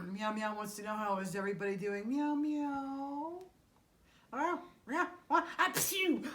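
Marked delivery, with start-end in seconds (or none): 2.1-3.3 much higher cute cat voice; tiny high kitty meow; kitten puppet voice. Pretend. 4.3-5.5 a higher, cute puppet kitty; playful high kitty; kitten puppet voice. Pretend. 5.6-6.2 high, loud mock kitten sneeze; playful cartoon kitty sneeze; puppet sneeze. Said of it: "you sneezed all over me".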